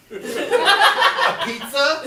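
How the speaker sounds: ordinary delivery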